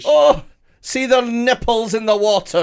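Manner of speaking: put-on high, loud Scottish accent; leering Scottish accent, hammed up. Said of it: "No, it went Scottish".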